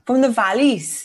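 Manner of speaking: slow, careful go at a Welsh accent. Shown as a demonstration. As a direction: copy a Valleys Welsh accent. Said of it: "say it in a Wales accent".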